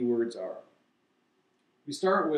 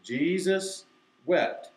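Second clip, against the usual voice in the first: higher, drawn-out group recitation; slow, deliberate; 4 semitones higher, much slower than usual. Shown as a demonstration. Teacher having everybody say it together, slow and deliberate. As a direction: lead everyone slowly, holding each word. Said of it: "I have to say it slowly, deliberately".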